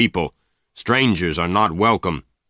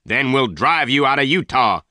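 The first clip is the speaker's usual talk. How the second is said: higher and louder threatening shout; 6 semitones higher, 4 dB louder than usual. A narrator's reading. Tull yells at Venters to leave Utah. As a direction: yell the threat after him. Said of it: "he shouted".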